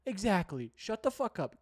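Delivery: ordinary delivery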